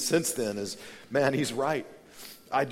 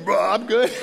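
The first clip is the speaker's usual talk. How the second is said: loud retch bursting into casual chat; mock mid-sentence vomit; 5 semitones higher, 8 dB louder than usual. Friend vomits mid-answer to "how you doing", mock retch. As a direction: act out throwing up while answering, louder and higher. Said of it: "somebody throw up mid-sentence".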